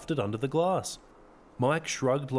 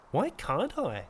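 innocent question; 5 semitones higher, slower than usual. A narrator's reading. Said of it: "more like an inquiry than a challenge".

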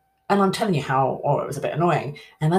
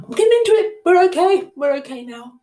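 much higher and louder, laughing at herself; excited; 13 semitones higher, 6 dB louder, faster than usual. Acted. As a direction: squeal it out, amused. Laughing at getting worked up.

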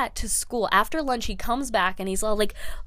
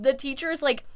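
ordinary talk

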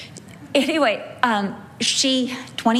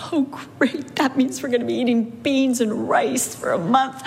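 sarcastic groan; 4 semitones higher than usual. Retold or imitated. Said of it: "which I was like".

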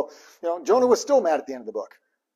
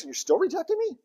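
hurt disbelief; 11 semitones higher than usual. Retold or imitated.

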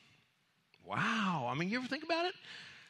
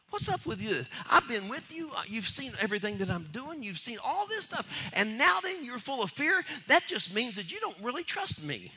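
exasperated Jesus; 8 semitones higher than usual. Retold or imitated. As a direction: confront them with their lack of trust. Jesus rebukes disciples.

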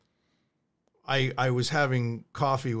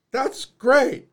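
sarcastic; 9 semitones higher, 4 dB louder than usual. Retold or imitated. Sarcastic reply to the lady.

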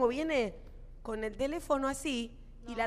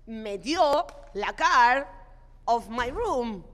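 slow pleading English; much slower than usual. Retold or imitated. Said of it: "yo le habré dicho algo así como".

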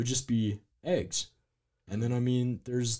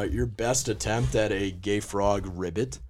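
usual speaking voice

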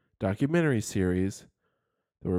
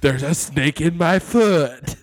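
slow laughing Woody line; imitating the Woody pull-string toy; 5 semitones higher, 9 dB louder than usual. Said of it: "When you pull the string".

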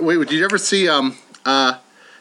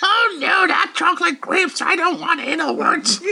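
horrified doll voice; 7 semitones higher than usual. Acted. Doll reacting to chocolate grapes.

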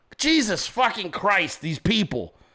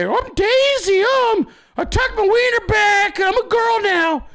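mock girly voice; 12 semitones higher, 4 dB louder than usual. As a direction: squeal it as a hammed-up girl. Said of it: "If you don't stay in character".

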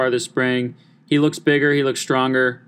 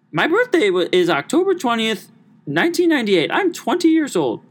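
much higher, gushing put-on lady voice; mock older lady; 10 semitones higher than usual. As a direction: gush like a sweet older lady on the phone. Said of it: "she was like".

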